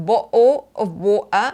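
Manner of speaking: BOTTLE OF WATER with glottal stops for each T; non-RP dropped T. Shown as a demonstration. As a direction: drop the Ts in BOTTLE OF WATER, bo'le o' wa'er. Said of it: "อันนั้นก็จะไม่ใช่ received pronunciation". (bottle of water กลืนเสียง T หายไป)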